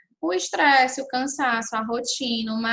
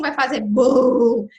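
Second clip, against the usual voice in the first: louder, slow mock explosion on bum; dramatic boom; 7 dB louder, much slower than usual. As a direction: make the fuse go boom slowly. Mother warns child about short fuse.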